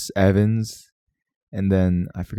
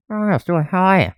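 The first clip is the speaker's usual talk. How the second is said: much higher cartoon voice; Seth MacFarlane doing Peter Griffin; 10 semitones higher than usual. Host shows his friend how the creator does it, high impression. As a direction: demonstrate a voice actor's cartoon character. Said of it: "I saw the way he did it".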